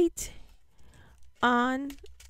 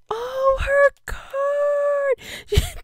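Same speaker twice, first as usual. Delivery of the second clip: touched; 12 semitones higher, much slower than usual. Real, not acted. Finding friend's card.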